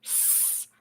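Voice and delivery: "S" as a soft unvoiced sss; letter sound S. Shown as a demonstration. Teacher shows the first sound of S, a soft hiss. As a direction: hiss the "S" quietly. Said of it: "What are the sounds of S?".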